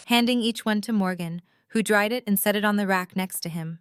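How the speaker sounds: ordinary talk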